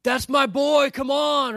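high and loud cheer from the stands; a dad cheering his son's home run. Retold or imitated. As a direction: shout it like a proud dad at the ballgame. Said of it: "dad's going".

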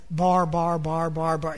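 low, even monotone drone; mock gibberish on one low note; mimicking foreign babble. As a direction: run the syllables together as meaningless noise. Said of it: "all it sounds like to us is".

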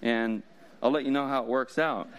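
usual speaking voice

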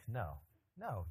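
ordinary talk

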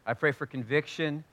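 soft, low, unhurried praying; quiet prayer. Acted. Pastor praying softly over his congregation, hushed prayer. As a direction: pray intimately over the church.

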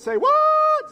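high, loud say what; shouted say what. Shown as a demonstration. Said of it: "And this week it's".

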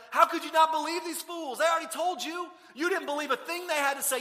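loud, high, exasperated scolding; berating. Acted. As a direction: berate him like a mock-furious teacher. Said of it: "he doesn't berate him".

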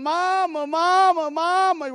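high, loud drawn-out cries for Mama; hollering child. Retold or imitated. Little boy calls his mama from the bathroom, hollering. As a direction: holler like a kid calling for his mother. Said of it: "he starts hollering".

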